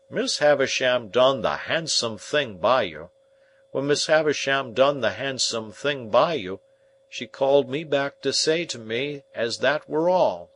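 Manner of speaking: a hearty, homely smith; Joe the blacksmith. Acted. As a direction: speak as plain, plodding Joe, weighing every word.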